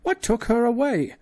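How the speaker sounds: very high, loud fearful cry; old father in panic; high frantic old man; alarmed old professor. Acted. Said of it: "cried Professor Porter".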